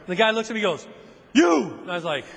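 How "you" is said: cop barking. Retold or imitated. Cop singling him out.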